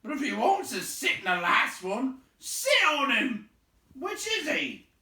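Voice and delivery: high, loud troll demand. A narrator's reading. Tom demanding which one, loud and high. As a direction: bellow the question. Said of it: "said Tom".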